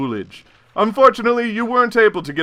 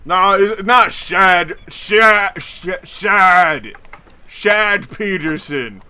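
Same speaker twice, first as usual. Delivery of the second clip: put-on loud, slow slurred drunk voice, higher; drunk slurred name; 6 semitones higher, 6 dB louder, much slower than usual. Drunk man slurring his own name loudly. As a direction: drunk Chad correcting the stranger, loud slurring.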